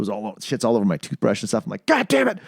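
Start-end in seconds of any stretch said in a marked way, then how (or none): 1.9-2.4 frustrated curse. Retold or imitated. Retold frustration at broken vaporizer.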